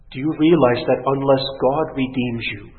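ordinary talk

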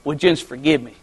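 put-on low, hushed apology to children; imitating a sorry daddy, voice dropped low; humble fatherly apology. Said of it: "Get down on their level and say".

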